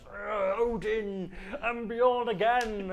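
loud, slow, high roar; viking berserker voice. Retold or imitated. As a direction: bellow it like a raging Viking. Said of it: "He just kinda goes".

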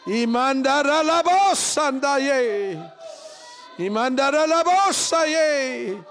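loud, high ecstatic tongues; shouting in tongues. Real, not acted. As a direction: cry out in tongues with full force. Shouting in tongues at prayer peak.

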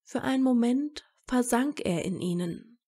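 usual speaking voice

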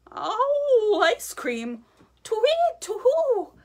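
very high, loud hooting panic; frightened owl hoot. Acted. Owl hearing about owl ice cream, hooting in panic. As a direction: hoot in terror like a startled owl.

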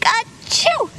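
one high sharp achoo; sneeze. Acted. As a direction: let a sneeze burst out. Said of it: "I can't stop sneezing".

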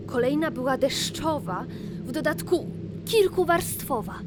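ordinary delivery

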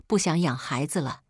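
ordinary delivery

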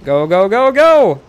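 urgent high yell, rising as it goes; urgent shouting. Real, not acted. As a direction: yell like you're racing for cover. Urging self into the cave.

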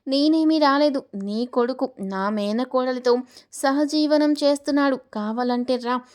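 ordinary delivery, nothing marked

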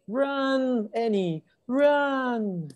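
high, drawn-out cry of alarm. A narrator's reading. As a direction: call it out high, stretching each "run". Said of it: "cried Jack".

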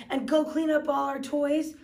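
ordinary delivery

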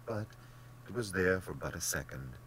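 usual speaking voice

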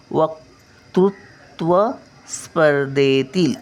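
वक्तृत्व स्पर्धेतील said slowly with the क stressed; slow clear वक्तृत्व स्पर्धेतील; careful model reading. Shown as a demonstration. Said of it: "आता उच्चार बघा". (वक्तृत्व स्पर्धेतील सावकाश उच्चार)

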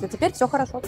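ordinary talk

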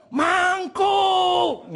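high, drawn-out yell on two syllables; shouted call. Retold or imitated. Lady on a motorbike yells at a badly parked driver, shouting. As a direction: holler it out long and high. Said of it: "she shouted".

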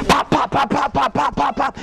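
high, rapid popping slap noises; slap sound effects. Retold or imitated. Mimicking slaps on Brock's head, high popping sounds.

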